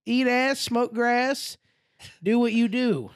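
high, slowed rhyming send-off; acting out a drunken goodbye call; jaunty farewell. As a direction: chant a cocky parting catchphrase. Drunkenly calling farewell while leaving.

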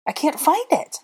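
high-pitched distressed cry; cried out. A narrator's reading. Hermione searching the stone floor, cried out. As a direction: wail it in alarm. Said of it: "Hermione cried".

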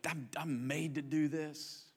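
soft, slow, sincere certainty; quiet conviction. Retold or imitated. Worker confessing love for the job, softly sincere. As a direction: say it like a calling. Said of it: "you're like".

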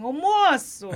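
put-on loud call with a Rio accent; Carioca accent. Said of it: "Falando carioca". (chamando com sotaque carioca)

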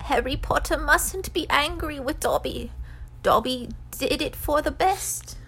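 high, pleading elf voice; Dobby. A narrator's reading. House-elf confesses to Harry, high and pleading. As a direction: plead as a timid little elf.

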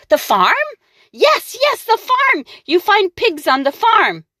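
high triumphant witch; delighted witch. Acted. The witch realising pigs are on the farm, triumphant. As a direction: crow with delight. Witch finally finds the answer.

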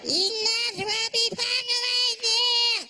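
high, slow brother voice again; imitating his brother. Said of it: "he started talking".

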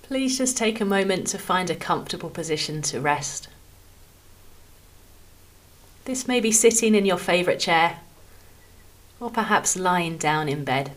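calm, measured phrases with long pauses between them; guided meditation. Acted. Podcast host guiding listeners through a body scan, calm meditation voice. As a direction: ease each phrase gently downward, leaving room to rest.

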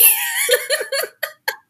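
loud high burst of laughter; shrieking laugh. Real, not acted. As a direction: laugh out in a squeal. Laughing at the retold moment.

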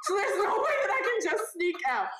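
high-pitched, squeezed through laughter; laughing. Real, not acted. Storyteller stuck in bright purple, laughing. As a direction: repeat it while cracking up. Repeats it cracking up over story.

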